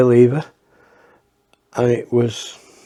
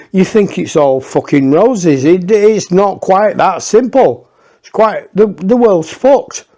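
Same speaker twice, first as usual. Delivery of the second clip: higher, louder, slowed-down exasperation; exasperated rant; 6 semitones higher, 3 dB louder than usual. Real, not acted. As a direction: throw your hands up at the absurdity. Exasperated aside to the audience.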